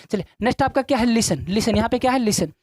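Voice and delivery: usual speaking voice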